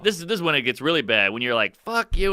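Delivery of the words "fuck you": higher-pitched, contained mock anger; understated angry retort; retold anger. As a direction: re-enact snapping without the volume. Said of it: "I just got angry".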